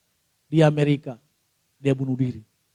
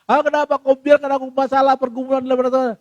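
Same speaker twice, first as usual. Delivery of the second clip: high, loud, run-on complaint; grumbling at God; 7 semitones higher, 7 dB louder than usual. Acted. Believer grumbling to God about their struggles, loud complaining. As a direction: pour out a noisy grievance, pitched up. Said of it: "Ngedumel sama Tuhan".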